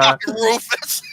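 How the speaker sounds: slow words through laughter; laughing indignation. Real, not acted. Laughing at the Rufus comparison.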